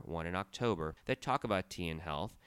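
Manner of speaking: normal talking voice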